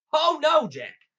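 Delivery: loud high cry of dismay; alarmed protest. A narrator's reading. Giant stopping Jack taking the creek, alarmed. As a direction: burst out to stop him.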